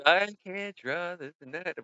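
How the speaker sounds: high, slow whiny imitation; mock complaint. Host mimics someone who says they can't draw, mock whine. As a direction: drag it out high, mocking a defeatist.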